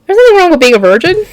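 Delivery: quick high aside to the screen; reassuring quote. Retold or imitated. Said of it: "I'm like".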